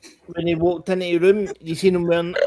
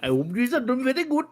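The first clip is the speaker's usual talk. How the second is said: high, bright mock Indian accent; 7 semitones higher, faster than usual. Shown as a demonstration. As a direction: do a hammy Indian accent for a policeman. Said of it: "I can do an Indian accent as well".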